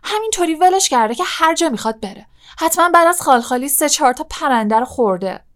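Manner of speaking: irritated, high-pitched rapid complaint; angry. A narrator's reading. Ron complaining to Harry about the loose cat, angry. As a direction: grumble fast and high, annoyed. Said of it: "رون با عصبانیت گفت".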